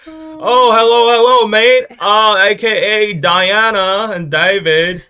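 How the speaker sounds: loud, high hammed-up British accent; exaggerated British band-member imitation. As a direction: bellow a slow mock-British hello. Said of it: "trying to just imitate ... Oasis".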